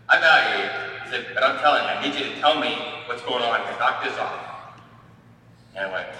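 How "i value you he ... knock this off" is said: loud, insistent, hammering each phrase; an older brother laying down the law, much louder; retold forceful demand.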